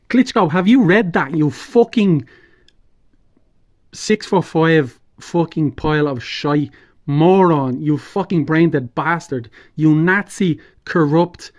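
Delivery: loud, furious tirade of insults; furious shouting. Real, not acted. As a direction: shout the insults in real rage. Furious rant at Klitschko.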